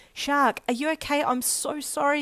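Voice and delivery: high, anxious cry of apology; alarmed yell. A narrator's reading. Friend checking him over after the fall, yelling in alarm. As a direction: cry out in worry, pitched right up. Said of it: "you yelled".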